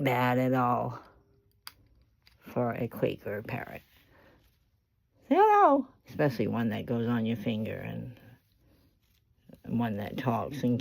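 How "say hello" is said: coaxing pet talk. Real, not acted. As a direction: coax a parrot in a squeaky voice. Coaxing the parrot to speak.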